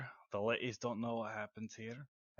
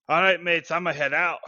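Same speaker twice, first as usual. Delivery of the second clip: loud, higher farewell to the room; hearty, raised-voice announcement; calling out; 7 semitones higher, 13 dB louder than usual. Acted. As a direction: holler it to all your drinking mates. Announces departure to the bar.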